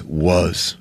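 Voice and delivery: a low, soft tough-guy grunt of a word; Seagal impression. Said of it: "He goes".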